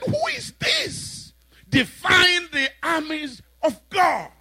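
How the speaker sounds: high shouted challenge from the giant; booming Goliath. Acted. Giant taunting the fearful army, shouting high. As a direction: bellow it out like a mocking giant.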